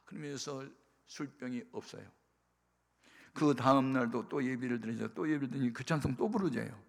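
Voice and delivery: ordinary talk, nothing marked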